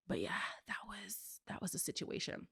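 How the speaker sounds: trailing whisper. Real, not acted. Speaker quietly wrapping up the story, whispered. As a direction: whisper the wrap-up.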